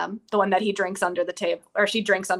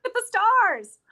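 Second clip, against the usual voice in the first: high, loud gleeful cry; excited high-pitched outburst; excited shout; 12 semitones higher, 9 dB louder than usual. Real, not acted. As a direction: shout it out in sudden recognition. Excited recall of a name.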